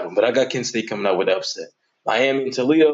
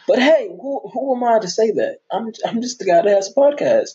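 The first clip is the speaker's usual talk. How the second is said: mock-humble; 11 semitones higher than usual. Real, not acted. Podcaster mock-humbly brushing off his own take, pitched high. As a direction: play it falsely humble.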